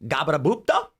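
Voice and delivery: lightly voiced made-up foreign yell; foreign gibberish. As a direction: shout nonsense as a foreign stranger. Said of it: "I can't understand this guy".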